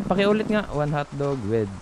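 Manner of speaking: usual speaking voice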